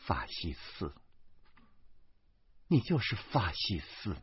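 low-volume, muttered accusation; hushed name-calling, well under the others; jeering along. Acted. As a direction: chime in with a soft, scornful jab. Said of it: "严明也跟着起哄".

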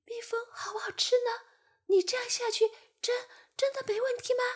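high, quick fly voice, eager and unsure; ask, curious and a bit nervous; excited curious fly. Acted. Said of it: "又兴奋又好奇地问". (兴奋好奇的苍蝇)

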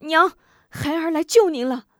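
calling out loud and high to his mother; urgent cry. Acted. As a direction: burst in shouting for mother. Son bursts in to rescue mother.

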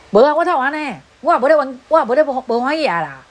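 mock loud denial. Imitating a hot-tempered partner shouting he is not upset. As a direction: bark out the denial. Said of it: "你讲话就是足大声的啊".

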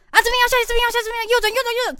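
mock a flustered passenger barking directions; panicky navigator. Shown as a demonstration. Passenger imitating a panicky navigator to the driver, frantic. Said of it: "不可以很紧张说".

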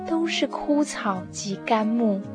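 ordinary talk

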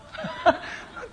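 高声无声地笑; breathless high-pitched laughter; high wheezing laugh. Real, not acted. (老师念完俏皮话后高声无声地笑)

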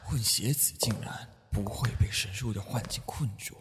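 hushed, breathy aside; whisper. Acted. The priest whispers to himself about the half-blood child. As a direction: keep it secret, just a whisper. Speaks secretly in hiding.

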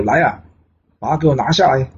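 the king in a rage ordering the guards to seize Jiang Ziya, angry; angry command. Acted. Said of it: "纣王听了勃然大怒".

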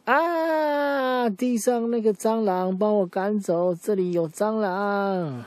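very high, slow mock panic; boy begs for help with a cockroach, high and frightened; scared boy. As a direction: squeal like a boy terrified of a bug. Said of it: "那个男生就说".

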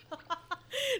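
short rising giggle; a quick high soft laugh. Real, not acted. Laughs at own retold reply.

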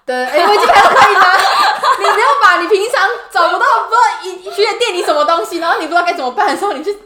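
high, loud words through laughter; laughing tease. Real, not acted. Laughing while teasing her co-host about Wikipedia.

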